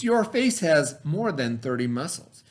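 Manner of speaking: ordinary delivery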